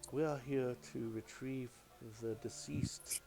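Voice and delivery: lightly voiced solemn cleric. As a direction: announce yourselves gravely. Clerics address Elena formally.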